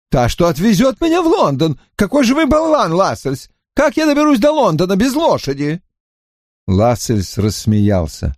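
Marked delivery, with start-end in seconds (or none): 0.1-5.8 high, fast, exasperated voice; indignant Drawlight. A narrator's reading. Drawlight berating Lascelles, high and quick. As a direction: fume at him for the missing horse.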